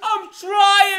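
squeaky yelled reply; high mock shout. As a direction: shout back in a silly voice. [name] answers in skit.

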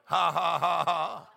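light laughing bursts. Shown as a demonstration. Preacher laughing in the Spirit, light. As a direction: laugh as if you can't help it. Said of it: "I just start laughing and laughing".

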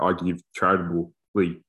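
ordinary talk

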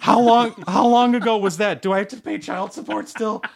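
panicked high. Acted. Vader panicking.

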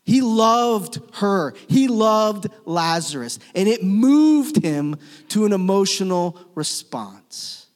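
slow, loud, heavily weighted declaration; impassioned preaching. Real, not acted. Preacher telling the congregation of Jesus's love, impassioned. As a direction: drive each phrase home with drawn-out emphasis.

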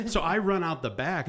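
usual speaking voice

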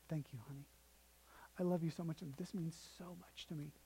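hushed, loving father's voice; tender thanks. Retold or imitated. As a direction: thank a child gently, letting it fade. Dad accepts the daughter's gift.

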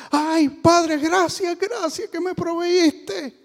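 high, slow, gushing thanks; a lightly voiced old lady thanking God; mock grateful old woman.

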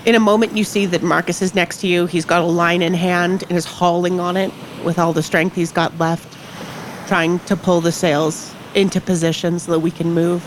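ordinary delivery

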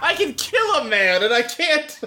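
high voice cracking with laughter; laughing frustration. Retold or imitated. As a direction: whine it through laughter. Mocking Ryusei failing at switch.

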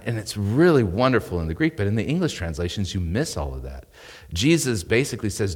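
usual speaking voice

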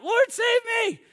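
high, loud panicked cry, acted; frightened cry. Sinking Peter crying out to Jesus, frightened cry. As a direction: cry out for rescue. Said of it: "afraid and beginning to sink, cried out".

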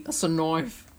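mock Australian movie quote; put-on Aussie accent. Shown as a demonstration. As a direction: quote it like Crocodile Dundee, in Aussie. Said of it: "from Crocodile Dundee".

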